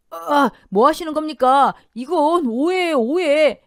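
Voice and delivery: high, loud cry of innocence; panicked protest. A narrator's reading. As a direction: protest wildly as you are seized. Husband protests his arrest.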